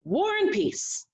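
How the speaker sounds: dad teasing, calling back to mom. A narrator's reading. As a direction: tease her from down the block.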